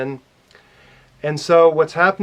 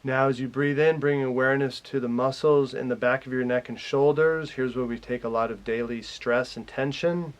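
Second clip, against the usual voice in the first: calm meditation guide, growing softer and lower; guided meditation; 3 semitones lower, 7 dB quieter than usual. Real, not acted. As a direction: lead them gently through relaxing, with long pauses. Leads a guided relaxation.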